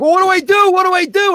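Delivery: high, loud helpless clown; clown asking kids. Retold or imitated. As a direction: beg the audience for help. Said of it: "And I'm like".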